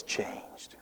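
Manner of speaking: word nearly lost in emotion; choked whisper, fighting tears; voice breaking. Real, not acted. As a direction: let "changed" break off into a near-silent whisper. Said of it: "I got emotional".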